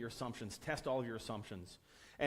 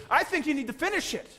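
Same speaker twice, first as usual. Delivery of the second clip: high, loud shout; angry yelling; 13 semitones higher, 10 dB louder than usual. Retold or imitated. Product owner yelling at the developer, high angry shout. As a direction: bellow it as an exasperated boss.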